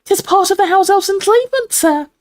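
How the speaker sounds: very high, earnest elf squeak; serious house elf. A narrator's reading. Dobby explains elves' duty, serious squeak. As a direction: explain it gravely in a tiny squeak.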